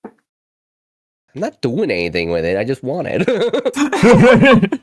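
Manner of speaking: drawn-out, slow, laughing admission; giggly confession. Real, not acted. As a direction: confess it through a laugh, stretching the words. Laughing confession about wanting the rock.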